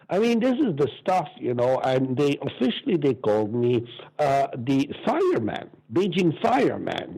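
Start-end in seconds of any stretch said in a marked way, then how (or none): none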